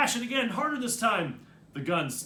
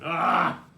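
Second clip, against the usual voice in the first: pained yelp; 6 dB louder than usual. A narrator's reading. Scalded by hot water.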